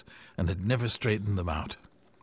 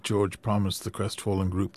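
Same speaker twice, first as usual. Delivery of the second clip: ordinary delivery, nothing marked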